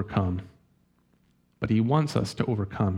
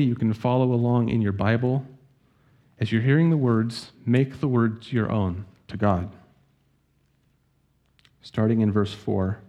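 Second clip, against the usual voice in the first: ordinary delivery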